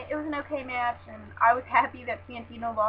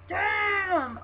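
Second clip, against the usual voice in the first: high startled "Damn!"; shocked exclaim; 9 semitones higher than usual. Retold or imitated. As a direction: blurt it out in shock. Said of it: "which I was like".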